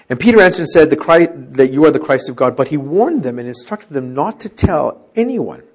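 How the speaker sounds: formal Bible reading voice; scripture reading. Acted. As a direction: read the verse out to the class. Reads scripture aloud.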